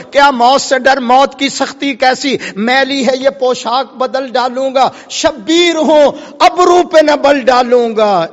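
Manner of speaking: high, driving rhythmic declamation; fiery sermon oratory; impassioned preaching. Acted. Preaching at full force, rhythmic.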